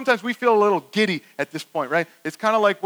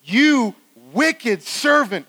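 slow, raised, thundering rebuke; condemning master; 4 semitones higher, 4 dB louder, much slower than usual. Acted. Master to the summoned servant, slow angry rebuke. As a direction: land each word as a heavy accusation. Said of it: "the master said to him".

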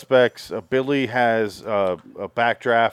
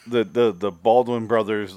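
ordinary talk, nothing marked

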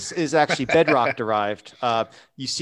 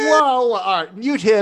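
mock alarm; 9 semitones higher, 6 dB louder than usual. Mock alarm at colleague's remark.

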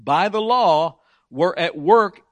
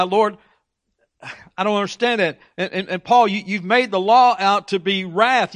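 objecting listener; 3 semitones higher, 4 dB louder than usual. Retold or imitated. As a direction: plead with Paul, confused and indignant. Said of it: "why someone may say, "But, but Paul".

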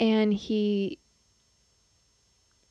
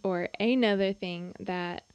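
ordinary talk

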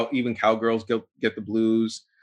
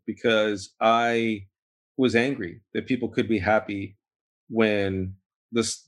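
ordinary delivery

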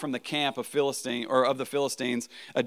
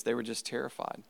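ordinary talk

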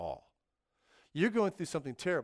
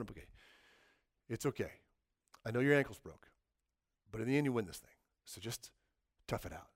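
resigned consolation; 6 semitones lower, 5 dB quieter, faster than usual. Retold or imitated. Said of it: "That's time traveler going".

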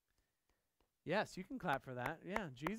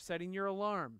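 ordinary delivery

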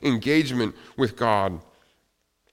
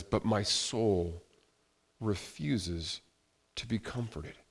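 hushed despair; 3 semitones lower, 9 dB quieter, slower than usual. Acted. Quoting psalm, despairing.

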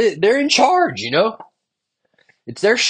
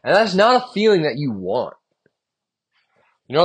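usual speaking voice

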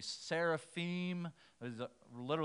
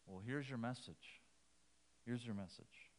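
quietly voiced divine answer, understated; soft, sober reply; 4 semitones lower, 10 dB quieter than usual. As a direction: hand over the task softly and gravely. Voicing God's reply to Isaiah.

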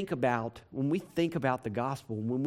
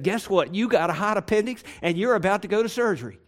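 louder, fast and breezy doctor; 7 semitones higher, 6 dB louder than usual. Retold or imitated. Preacher mimics the doctor breezily delivering bad news, fast and loud. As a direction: rattle off the news carelessly. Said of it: "he came bebopping in and he said".

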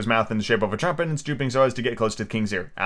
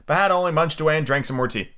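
ordinary delivery

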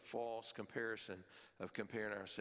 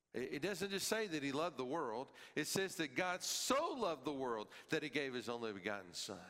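ordinary delivery

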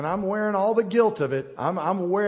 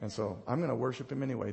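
soft, lowered, heartfelt declaration; quiet resolve; 6 semitones lower, 8 dB quieter than usual. Retold or imitated. Preacher's retold answer to the enemy, quietly resolved. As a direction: say it low and humbly, with pauses.

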